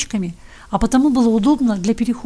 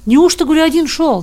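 louder, higher repeated question; insistent questioning; 5 semitones higher, 5 dB louder than usual. A narrator's reading. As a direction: press him for an answer. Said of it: "допытывался сторож". (громко допытываясь)

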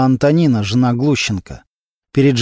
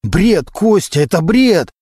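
higher-pitched, exasperated outburst; indignant protest; 5 semitones higher, slower than usual. A narrator's reading. Suspect calling the evidence nonsense to investigator, indignant. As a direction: throw the accusation back in protest.

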